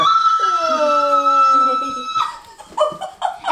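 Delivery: long, loud excited laugh. Real, not acted. Family teasing mom with a surprise gift, long excited laugh. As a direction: burst out in a drawn-out laugh.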